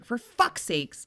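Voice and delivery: mock exasperation, slow and high; exasperated. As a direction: drag it out, fed up. Vibrator died, exasperated quote.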